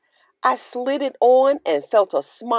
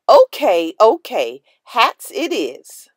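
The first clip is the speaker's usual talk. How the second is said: loud giving in; 6 dB louder than usual. A narrator's reading. Teacher gives in, loudly.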